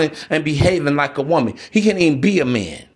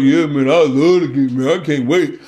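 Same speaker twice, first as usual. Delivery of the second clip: sarcastic; 3 semitones lower than usual. Pretend. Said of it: "understand my voice".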